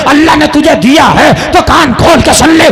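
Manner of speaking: loud preaching exhortation; full-voice sermon admonition; preaching at full force. Acted. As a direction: call out to the brothers with force.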